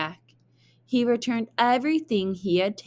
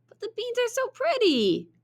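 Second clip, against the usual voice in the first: put-on much higher, wide-eyed child voice; dreamy boy; faster than usual. Jack pleads with his mother in a high childish voice. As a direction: gush over the pretty beans like a small boy. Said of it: "said Jack".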